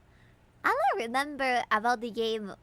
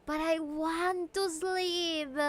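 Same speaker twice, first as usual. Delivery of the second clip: whiny; 4 semitones higher, much slower than usual. Acted. Whiny high slow plea.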